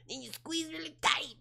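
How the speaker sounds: high, strained comic voice; movie character impression. Streamer quoting a movie for chat, high comic voice. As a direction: squeeze the words out up high. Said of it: "Who knows what this is a reference to?".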